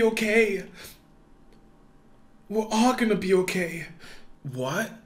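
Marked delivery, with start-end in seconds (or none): none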